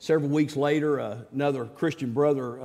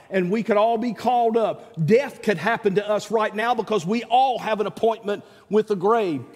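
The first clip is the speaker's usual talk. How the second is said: higher, louder voice of a believer declaring trust; 7 semitones higher, 4 dB louder than usual. Retold or imitated. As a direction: proclaim it like a soul ready to go home. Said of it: "A level that says".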